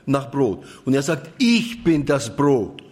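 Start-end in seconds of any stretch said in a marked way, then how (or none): 1.4-2.8 „Ich“ gedehnt und kräftig betont, langsam; Betonung auf Ich. Shown as a demonstration. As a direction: setz den ganzen Nachdruck auf „Ich“. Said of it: "dann liegt die Betonung immer auf ich". (langsam, mit schwerem Akzent auf „Ich“)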